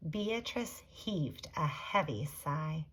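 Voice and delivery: plain reading voice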